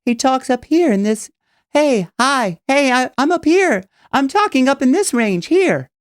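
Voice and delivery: high, light head-voice impression. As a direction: imitate a singer talking way up high. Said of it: "Listen to [name] speak".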